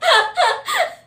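high, loud laugh. Real, not acted. Laughing at her own divorce joke, high and loud.

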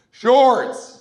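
short loud high call from the back; shouted one-word answer. Retold or imitated. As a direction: bark it out from the crowd. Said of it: "one guy in the back goes".

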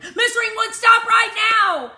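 very high, loud, slowed shout; frantic shout. A narrator's reading. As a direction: yell the order at full voice. Child shouting at driver.

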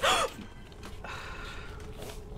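sudden startled gasp; sharp gasp. Real, not acted. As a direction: gasp in fright. Startled by a jump scare.

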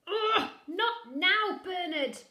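very slow, high, loud groaning ugh; pained brush-off. Acted. Bitten father still brushing Bernard off, pained. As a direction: stretch the ugh, bitten but busy.

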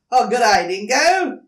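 a hint of a snappy platypus, loud. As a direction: snap out the greeting. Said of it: "snapping his bill".

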